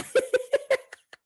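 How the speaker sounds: a very high giggle. Real, not acted. Laughing at the story.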